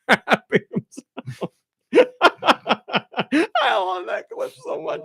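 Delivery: talking through helpless laughter; high-pitched, words breaking up with laughter; laughing hard. Real, not acted. Cracking up at the clip.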